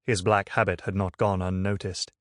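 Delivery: ordinary delivery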